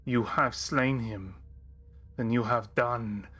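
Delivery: measured, mournful noble fighter; solemn warrior voice. Acted. DM voices grieving warrior NPC.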